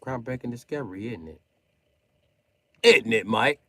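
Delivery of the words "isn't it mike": much louder, mocking jab; loud taunt. Real, not acted. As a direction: shout the taunt at Mike. Taunting Mike.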